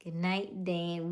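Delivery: ordinary talk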